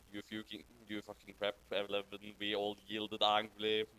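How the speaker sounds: normal talking voice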